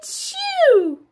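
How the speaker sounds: loud high acted sneeze; hammed-up achoo; big sneeze. Wolf sneezes down the pig's straw house, big fake sneeze. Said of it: "I sneezed a great sneeze".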